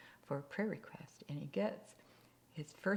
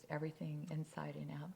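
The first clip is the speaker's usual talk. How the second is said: ordinary talk, nothing marked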